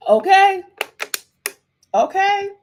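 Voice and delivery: hyping the chat, high and loud; emphatic okay. Real, not acted. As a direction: sing it out like a hype cheer.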